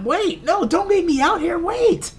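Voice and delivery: high-pitched pleading cry, hammed up; mock panicked, high calling out; Anne. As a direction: imitate a woman begging not to be left behind. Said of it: "Anne is telling him".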